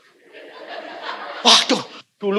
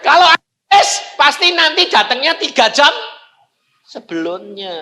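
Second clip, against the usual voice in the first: shouting, higher and louder; loud argument; 6 semitones higher, 5 dB louder than usual. Retold or imitated. Preacher argues with the ticket clerk about sold-out seats, shouting.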